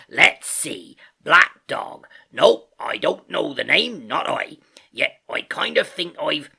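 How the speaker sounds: loud, drawn-out sailor voice, easing at the end; Silver musing aloud. A narrator's reading. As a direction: think it out loudly for the room. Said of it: "he ran on again aloud".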